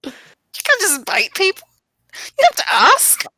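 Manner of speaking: high, loud outraged protest; shrill indignation at a biting vampire; indignant scolding. Acted. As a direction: scold him like he's lost his manners. Player scolding vampire in character.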